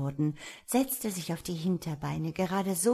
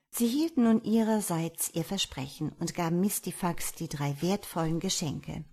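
ordinary delivery, nothing marked

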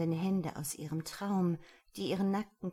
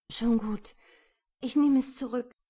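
high, louder, rattled concession; giving in; 7 semitones higher, 9 dB louder than usual. A narrator's reading. Intimidated woman takes it back, giving in. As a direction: back down with a raised voice. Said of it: "gab sie nach".

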